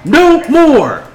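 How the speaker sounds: high, loud battle cry from a speaker; leader's rallying yell. Acted. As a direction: yell it out to rouse the crowd. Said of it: "she yells".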